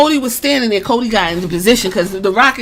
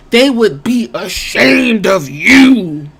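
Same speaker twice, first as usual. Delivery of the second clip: "ashamed of you" stretched slowly and hit hard; drawn-out scolding; 4 dB louder, much slower than usual. Retold or imitated. Cody scolding two rivals about their ancestors, drawn-out and emphatic. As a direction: draw out "ashamed" and "you" for weight.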